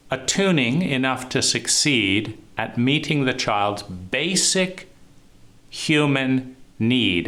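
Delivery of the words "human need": slow, with need drawn out and underlined; stress on need. Shown as a demonstration. Teacher underlining need for his listeners, slowly. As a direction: lean hard on the word need. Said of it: "Emphasize the word need, underline it".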